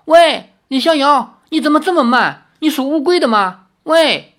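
high-pitched, loud impatient calls; urgent, exasperated shouts, pitched right up; anxious shouting. A narrator's reading. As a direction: holler for someone lagging far behind. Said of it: "叫道".

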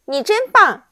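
high, bright burst of gratitude; warm thanks. A narrator's reading. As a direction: thank her with delight. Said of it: "热情地感谢小樱桃".

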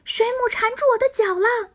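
high startled yelp; startled cry. Acted. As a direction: caught off guard by a jellyfish. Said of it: "他一惊".

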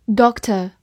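"doctor" the British way, no final r; British pronunciation. Shown as a demonstration. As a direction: say "doctor" in British English, short rounded o. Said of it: "或者美式发音".

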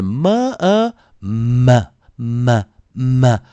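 么 as "mmm" opening into "uh"; separated "mmm-muh" repeats of 么; heavy dark M. Shown as a demonstration. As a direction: press lips, hum, release 么 on a schwa. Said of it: "比较重的M就是么".

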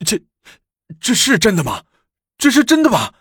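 受惊的皇帝声音越说越高，结结巴巴; stammering, rising to a high panicked cry; terrified. A narrator's reading. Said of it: "李治吓得汗毛倒竖". (吓得发抖，反复追问)

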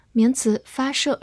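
normal talking voice

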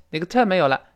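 ordinary talk

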